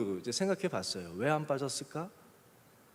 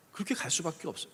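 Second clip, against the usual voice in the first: usual speaking voice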